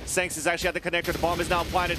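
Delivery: usual speaking voice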